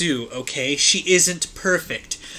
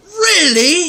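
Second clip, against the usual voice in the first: high, loud mock whimper; hammed-up whimpered question; 13 semitones higher, 8 dB louder than usual. A narrator's reading. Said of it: "he whimpered".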